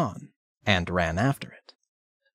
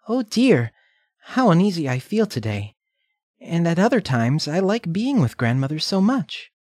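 put-on uneasy little girl; 8 semitones higher than usual. Redcap feels uneasy.

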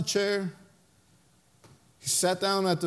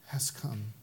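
soft low awe; hushed reverence; 11 semitones lower, 13 dB quieter than usual. Acted. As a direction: drop to a reverent hush. Reverent climax.

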